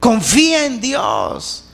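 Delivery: usual speaking voice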